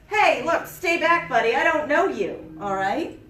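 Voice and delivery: loud, high stern warning; firm warning shout. Shown as a demonstration. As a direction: warn off a stranger firmly. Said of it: "you recognize he is following you".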